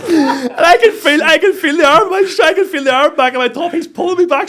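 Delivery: high, loud, laughing recount; worked-up retelling. Retold or imitated. Coach reliving being pulled back by his top, loud and worked up. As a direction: tell it at full pitch through laughter.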